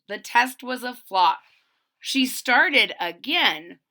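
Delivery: rhyming picture-book read-aloud; storybook narrator; story narration. A narrator's reading. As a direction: read to young children, landing each phrase.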